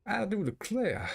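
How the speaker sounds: exaggerated southern gentleman; old southern gentleman. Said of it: "talk like an old southern slave owner".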